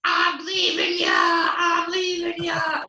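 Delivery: very high, drawn-out singsong wail; mock her wailing from the taxi; yelling girlfriend. Girlfriend in the taxi wailing at the drunk, high and stretched out. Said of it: "yelling at him".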